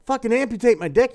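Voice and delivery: high, loud, fast panic; the patient yells in horror at the news; panicked outburst. Acted. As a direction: blurt it out in horror. Joke character panics.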